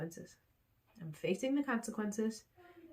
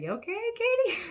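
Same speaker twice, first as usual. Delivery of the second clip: very high, loud, laughing mock concern; hammed-up sing-out check-in through laughter; imitated trainer; 5 dB louder than usual.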